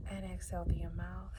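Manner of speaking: soft, hushed meditation voice with long pauses; quiet breathing instructions, left to settle in silence; guided meditation. Acted.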